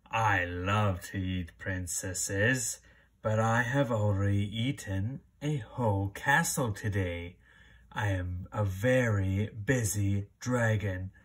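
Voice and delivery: very low, slow booming dragon. Acted. Dragon talking to the princess at his door, deep and slow. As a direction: rumble it like a huge bored dragon. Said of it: "The dragon stuck his nose out of the door and said".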